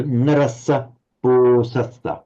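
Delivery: "narasa bo sasa" with a short a and a long o; slow model of "narasa bo sasa". Shown as a demonstration. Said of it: "you lengthen that a shortly".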